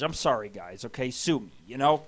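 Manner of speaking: ordinary delivery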